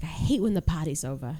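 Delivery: imitating an Australian party friend; quoted line in an amateur Australian twang; Australian accent. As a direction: put on a light Australian accent. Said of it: "he's Australian, he's like".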